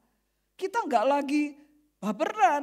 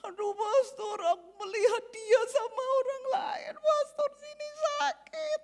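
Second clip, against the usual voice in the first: heartbroken whimper; 11 semitones higher than usual. Retold or imitated. Voicing a heartbroken youth.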